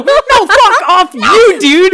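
high, loud, slow indignant retort; insulted shopkeeper. Acted. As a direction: shopkeeper throwing the insult back at Dak. Insulted shopkeeper snaps back.

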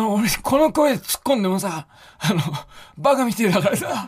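high, strained mock of a blown-out voice; imitating lost voice. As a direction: mimic your partner with no voice left. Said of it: "この声で、この声で、そう言ってたんです、あなた". (声が潰れた相方の真似)

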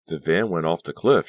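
breathless gasp. A narrator's reading. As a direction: gasp it, still shaken. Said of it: "she gasped".

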